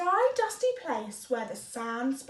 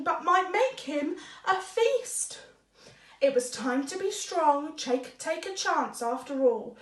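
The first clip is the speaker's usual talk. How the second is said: usual speaking voice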